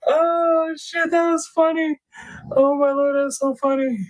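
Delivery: high, breathless laughing reaction; laughing squeal. Real, not acted. Streamer cracking up at a chat joke, squealing with laughter. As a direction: still cracking up at the chocolate joke.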